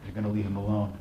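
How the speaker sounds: low-key, firm order; calm command. Acted. Bigger bodybuilder ordering the attacker off, calm command. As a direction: tell him evenly, no room for argument. Rescuer orders, grabber echoes.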